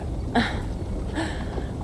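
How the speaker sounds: a quiet breathy chuckle; soft laugh. Acted. Richardis laughs softly at Eivor's blunt reply, breathy chuckle. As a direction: laugh under her breath, amused.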